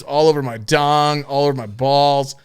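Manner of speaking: ordinary delivery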